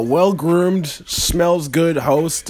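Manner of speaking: very slow drawn-out praise; mock flattering. Host teasing his co-host, slow mock praise. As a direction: savor each compliment slowly. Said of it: "flattering the co-host".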